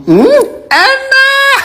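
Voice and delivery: high, slow drawn-out yum; savoring hmm. Real, not acted. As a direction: stretch the hum out with delight. Tasting egg, drawn-out high hum.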